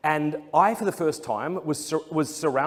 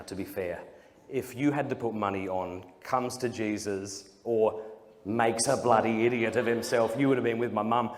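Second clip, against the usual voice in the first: ordinary talk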